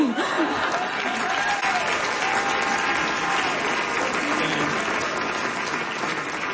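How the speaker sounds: real laughter rolling on; long laugh. Real, not acted. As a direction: laugh it out after the punchline. Laughter after the punchline.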